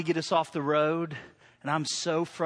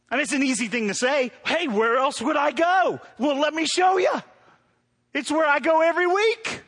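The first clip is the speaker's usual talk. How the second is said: loud, high preacher shout; preaching shout; 7 semitones higher, 4 dB louder than usual. Real, not acted. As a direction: call it out to the whole church. Preacher calls out to congregation.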